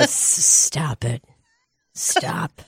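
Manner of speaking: put-on slow, low old-man impersonation dropping to a near whisper; very elderly man. Very elderly man rebuking Libby, slow imitated old-man voice. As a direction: draw it out softly as an old man. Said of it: "this man who is very elderly looks at her and goes".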